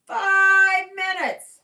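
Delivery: very high, loud, slow call; loud drawn-out call. Real, not acted. Calling out, repeated.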